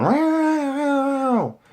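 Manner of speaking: high squeaky imitated meow; cat meow. Imitating the other cat wrestling, high meow. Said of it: "the other cat starts going".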